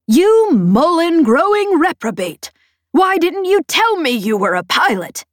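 high, loud scolding over the comm; outraged shout. A narrator's reading. Peg berates the pilot for hiding her skill, shouting. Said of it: "she shouted".

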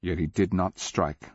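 ordinary delivery

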